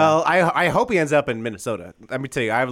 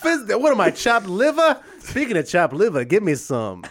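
put-on Yiddish accent; 6 semitones higher than usual. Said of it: "Billy Donovan".

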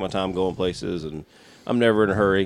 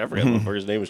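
ordinary delivery

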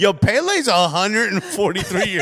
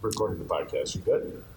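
low, hushed aside into the phone; quiet phone voice; 6 semitones lower, 7 dB quieter than usual. Real, not acted. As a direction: keep it down, you're on air. Talking quietly to wife on phone.

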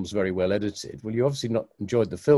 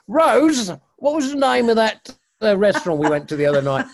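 much higher and louder call across the room; calling out to wife; 13 semitones higher, 8 dB louder than usual. Retold or imitated. Old man calls his wife Rose, loud and high. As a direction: shout for your wife to come help.

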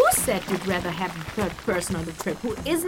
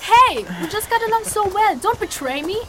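mock betrayed protest; 9 semitones higher, 5 dB louder than usual. Companion pretends to be betrayed.

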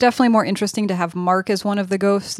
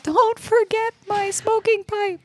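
high, slow pet voice; the dog's voice; 14 semitones higher, slower than usual. Pretend. Voicing the dog demanding her pipe, high slow pet voice. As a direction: play the fussy dog asking for her pipe. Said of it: "She's like".

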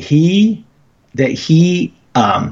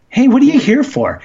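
much higher, upbeat ask; cheery question; 10 semitones higher than usual. Retold or imitated. Caller retelling asking Mead his visit, much higher cheery question. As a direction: lift it up like a friendly clerk.

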